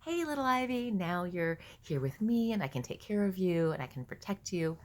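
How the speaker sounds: put-on high, delighted reassurance to a child; happy greeting. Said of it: "I'm really happy".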